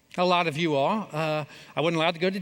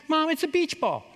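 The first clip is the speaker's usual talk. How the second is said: put-on kid protesting; 11 semitones higher than usual.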